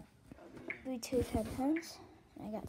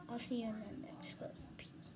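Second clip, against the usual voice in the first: whispering; 8 dB quieter than usual. Real, not acted. Whispered sign-off.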